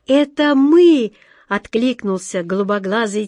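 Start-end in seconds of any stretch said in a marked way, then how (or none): 0.1-1.1 loud, high, drawn-out answer; long, raised call announcing arrival. A narrator's reading. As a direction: shout back slowly and high. Sailor calls back from the gangway.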